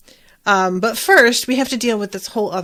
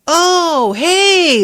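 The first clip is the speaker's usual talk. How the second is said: loud, high fake-friendly hello; mock cheery greeting; 8 semitones higher, 7 dB louder than usual. Al greeting his reckless brother, loud sarcastic cheer. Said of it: "Al is just like".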